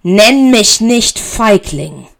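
slow, loud, enraged; shouting slowly in fury; furious shout. A narrator's reading. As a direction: spit every word in rage. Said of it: "schrie Severus".

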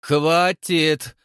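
loud, angry bark; angry shout. Acted. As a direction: cut them off with a shout. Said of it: "рассерженно крикнул Кощей".

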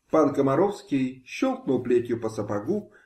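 usual speaking voice

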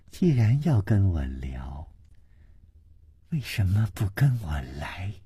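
soft, low, near-whispered tease; breathy flirtatious dare; teasing invitation. A narrator's reading. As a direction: lure him with a soft invitation. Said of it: "还笑了笑， 说".

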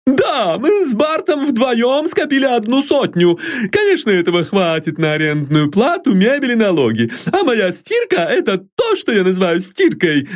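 put-on very high, firm, proud old servant; a maid boasting of her washing; high, resolute servant voice; determined maid. A narrator's reading. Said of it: "решительно заявила Дебора".

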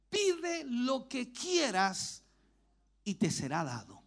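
slow solemn offer. Acted. Elijah speaks to Elisha.

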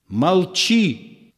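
sharp high cry to silence him; shouted command. Acted. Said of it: "крикнул Иоанн".

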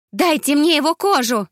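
high, loud cry of sudden fury; voice breaking in rage. Acted. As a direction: lose control of the voice on the last words. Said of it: "не удержал голос". (Мегарей в ярости срывается на крик, голос не выдерживает)

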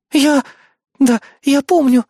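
high, guilty, halting reply; sheepish hesitation. A narrator's reading. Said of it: "замялась Бодикада, виновато опустив взгляд".

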